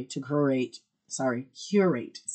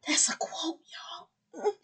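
whispered aside; whispering; 5 dB quieter than usual. Real, not acted. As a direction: whisper to the audience. Aside to listeners.

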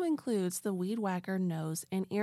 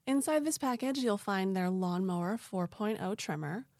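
ordinary talk, nothing marked

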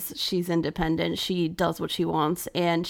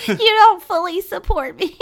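high, loud mock hurt; hammed-up offended mother; mock hurt mom; 12 semitones higher, 9 dB louder than usual. Joking as the mom.

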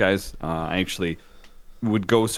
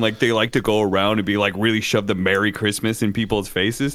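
usual speaking voice

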